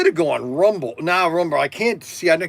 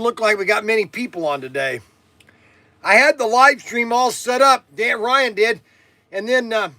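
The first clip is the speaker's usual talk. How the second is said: loud, high-pitched holler; yelling; 8 semitones higher, 6 dB louder than usual. Real, not acted. Streamer telling viewers about his live stream setup, yelling. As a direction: yell it out to the whole audience. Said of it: "why am I yelling".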